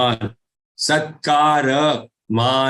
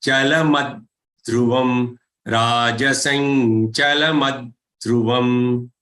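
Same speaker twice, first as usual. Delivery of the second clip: ordinary delivery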